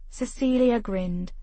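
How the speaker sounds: usual speaking voice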